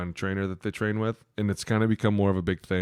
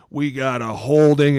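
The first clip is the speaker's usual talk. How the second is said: mock ref call; 5 semitones higher, 8 dB louder, slower than usual. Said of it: "he was like".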